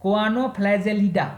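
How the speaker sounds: Choanoflagellida slowly, part by part; careful pronunciation. Shown as a demonstration. Teacher giving order three to students, careful pronunciation. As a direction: draw out Choanoflagellida so each part is clear. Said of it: "pronunciation पे ध्यान दीजिएगा".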